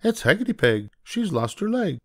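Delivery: high bird voice; chirpy blackbird telling. Acted. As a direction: blackbird naming the witch, high. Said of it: "the bird chirped".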